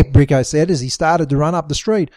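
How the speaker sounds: usual speaking voice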